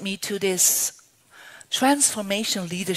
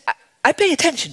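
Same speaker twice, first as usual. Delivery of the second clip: eager rush; 7 semitones higher, 7 dB louder, much faster than usual. Retold or imitated. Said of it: "you're so enthusiastic".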